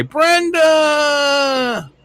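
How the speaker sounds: loud, high call of the name; shouted greeting. Shown as a demonstration. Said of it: "so you can say".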